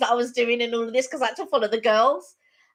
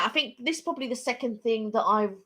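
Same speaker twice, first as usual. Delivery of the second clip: normal talking voice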